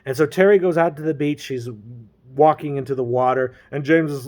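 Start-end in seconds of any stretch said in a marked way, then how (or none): none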